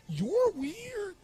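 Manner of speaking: very high, odd voice; drunk man's verdict. Retold or imitated. Drunk man telling the speaker he is weird, high odd voice. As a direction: blurt it out in a strange high voice. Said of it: "looked back at me and said".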